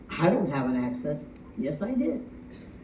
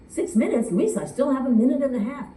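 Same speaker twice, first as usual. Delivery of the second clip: surprised protest; 8 semitones higher, 4 dB louder, faster than usual. Retold or imitated. Protesting contestant in retold scene.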